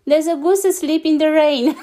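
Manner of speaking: giggly proud announcement; laughing through the line. Acted. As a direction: deliver the mix-up giggling. Garbled message, laughing.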